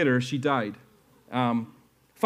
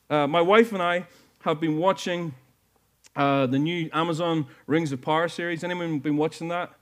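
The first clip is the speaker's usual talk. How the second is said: ordinary delivery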